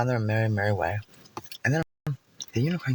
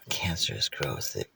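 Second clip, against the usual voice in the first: whisper; 12 semitones lower, 8 dB quieter than usual. Pretend. Spooky aside in story.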